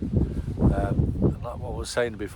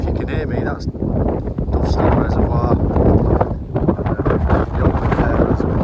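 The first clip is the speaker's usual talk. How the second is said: shouting over wind; 10 dB louder, slower than usual. Real, not acted. Windy hilltop, camera running.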